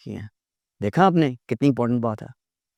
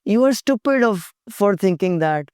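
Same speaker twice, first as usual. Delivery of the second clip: insulting; 6 semitones higher, much slower than usual. Shown as a demonstration. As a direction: sneer it at the client. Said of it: "अगला बेवकूफ़ समझेगा".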